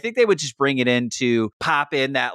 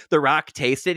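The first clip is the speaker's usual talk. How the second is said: ordinary talk, nothing marked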